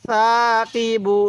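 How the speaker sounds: সাকিবু slowly drawn out on the long vowels; slow, sustained teaching take of সাকিবু; model recitation. Shown as a demonstration. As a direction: stretch সা and কি in সাকিবু. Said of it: "কীভাবে শিখব?".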